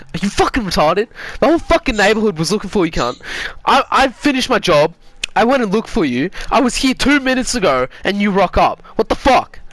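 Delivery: high, heated retold telling-off; imitated angry scolding. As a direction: rant like an exasperated uncle, acted out. Uncle scolding the runaway.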